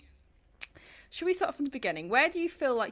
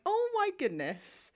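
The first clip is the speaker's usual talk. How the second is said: much higher, slowed-down "oh my goodness"; amazed gasp; 13 semitones higher, 3 dB louder, slower than usual. Retold or imitated. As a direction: relive the moment of disbelief, drawn out. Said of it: "and I was like".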